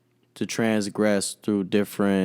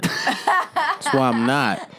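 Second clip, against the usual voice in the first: high, slow, through laughter; laughing; much slower than usual. Real, not acted. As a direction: drag it out laughing.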